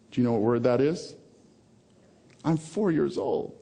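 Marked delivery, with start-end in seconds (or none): 2.4-3.5 put-on higher, slow protest as a small child; mock four-year-old. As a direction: play a baffled little kid. Plays his puzzled four-year-old self.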